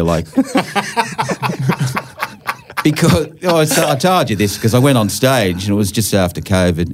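ordinary talk

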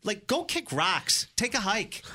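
exasperated, telling the woman to go away. Retold or imitated. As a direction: brush off a pest firmly. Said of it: "it's like".

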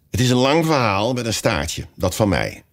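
ordinary delivery

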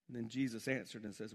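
normal talking voice

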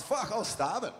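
imitating the driver. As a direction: toss it off like a hungry guy mid-bite. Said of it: "and he goes".

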